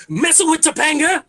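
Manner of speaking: high, loud, relished punchline; old-head voice. Acted. Comedian showing off his favorite line, high and hollered. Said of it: "just so I could say".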